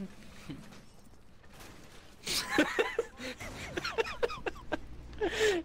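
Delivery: long, high, helpless laughing fit; hysterical laughter. Real, not acted. Player in stitches during the stream, hysterical laughter. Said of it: "make you laugh hysterically".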